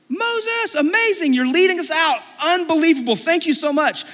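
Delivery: high, loud mock gushing; hammed-up elation. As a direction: cheer like an overjoyed, grateful crowd. Imagined grateful crowd cheering Moses.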